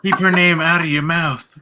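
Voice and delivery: high, loud, laughing shout; mock angry yell. Joking along about respect on her name, mock angry yell.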